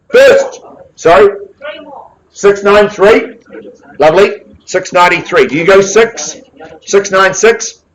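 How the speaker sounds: rally the room for opening bids; auction calling. Real, not acted.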